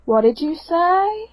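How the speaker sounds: London accent. Shown as a demonstration. Showing friends the one line they manage, London accent. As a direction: draw it out in a put-on London accent. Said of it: "I can't do a London accent unless".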